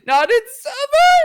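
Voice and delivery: mock a voice cracking upward; cracking high voice. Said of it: "your voice is scaled upward".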